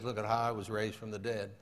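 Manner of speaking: low, soft, understated answer; lightly voiced Jesus. Jesus answers the boasting man, low and quiet.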